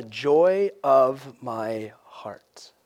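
very slow, hushed reading, trailing into near whisper; savoring hush. Acted. Preacher rereads the end of the verse, savoring hush. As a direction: linger softly over each word.

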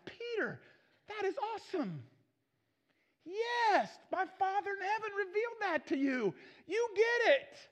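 high-pitched acted excitement; thrilled, voice lifted high without shouting; delighted praise. Jesus praising Peter for knowing who he is, delighted.

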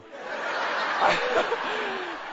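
a burst of high laughter; delighted high laugh; big high laugh. Real, not acted. Punchline of the story.

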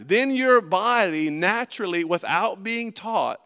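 normal talking voice

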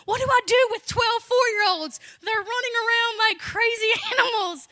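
mock freak-out. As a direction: play up your own panic. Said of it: "I'm freaking out".

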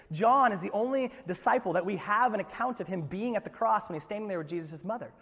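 ordinary delivery

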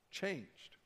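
breathy whispered repeat of the word Changed; whispered echo. Acted. Preacher echoes his promise to the congregation in a whisper. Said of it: "your life will be changed".

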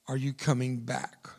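usual speaking voice

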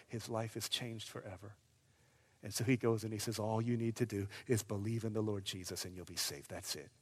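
low, very soft, grave sermon delivery; hushed preaching. Real, not acted. Preacher giving Paul's answer to the jailer, hushed. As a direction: let it drop to quiet simplicity.

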